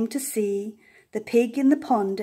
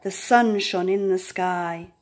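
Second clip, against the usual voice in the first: ordinary delivery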